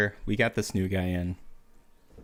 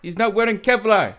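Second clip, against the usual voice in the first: much higher and louder, hammed-up foreign fighter; mock insurgent; 12 semitones higher, 9 dB louder than usual. Insurgent calling to Ahmed about shirtless soldier, hammed-up high shout. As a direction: ham up an excited enemy fighter calling to a buddy.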